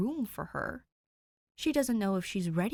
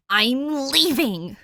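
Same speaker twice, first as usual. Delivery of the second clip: loud, slow, higher declaration; declaring; 6 semitones higher, 12 dB louder, much slower than usual. Acted. Danny, declaring to Dick that she's done, loud and slow. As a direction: plant your feet and announce it. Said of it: "she declares".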